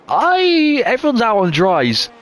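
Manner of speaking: surprised disbelief. Real, not acted. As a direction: blurt it out in disbelief. Rain, drivers on dry tyres.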